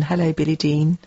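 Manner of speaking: ordinary talk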